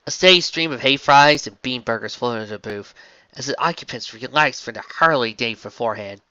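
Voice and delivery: ordinary delivery, nothing marked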